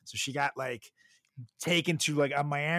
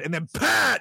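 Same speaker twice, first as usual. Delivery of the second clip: stab sound effect; 7 semitones higher, 5 dB louder than usual. Retold or imitated. Sudden stabbing in movie retelling.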